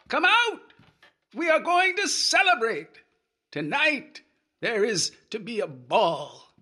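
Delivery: put-on high shrill cracked old man calling out; shrill old man cry. A narrator's reading. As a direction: crow it out like a giddy old man. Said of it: "he cried".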